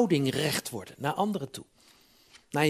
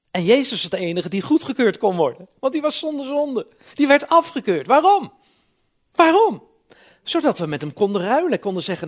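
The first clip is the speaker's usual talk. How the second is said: high, loud, rising sermon climax; high, loud preaching that climbs to a near shout; full-force preaching; 7 semitones higher, 8 dB louder than usual. Acted. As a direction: preach it out, building to a cry.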